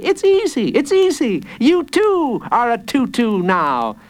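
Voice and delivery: put-on very high, gleeful child; joyous. A narrator's reading. Jacob celebrates the villain becoming like him, joyous squeal. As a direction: bounce it out in a high kid's cheer. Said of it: "jumping up and down joyously".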